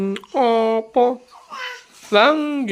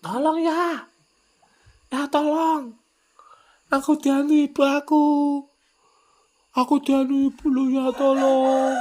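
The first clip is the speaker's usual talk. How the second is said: put-on pleading child voice; 8 semitones higher than usual.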